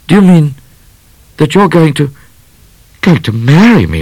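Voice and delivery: high, loud, halting question; flustered disbelief. Acted. Midnight asking if she'll marry him, flustered disbelief. Bashful Midnight stammers at proposal.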